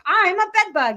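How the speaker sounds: high, loud sped-up bug voice; sped-up bed bug. Retold or imitated. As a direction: squeak it like a fast-forwarded bug. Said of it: "he speeds up the voice".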